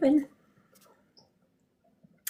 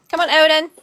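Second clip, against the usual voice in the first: calling the dog; 6 semitones higher, 7 dB louder than usual. Real, not acted.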